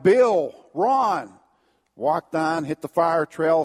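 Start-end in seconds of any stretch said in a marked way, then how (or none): 0.0-1.3 loud call of two names, a bit higher; calling names out loud across the woods; yelled names. Retold or imitated. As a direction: shout out for your buddies. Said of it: "But I yelled".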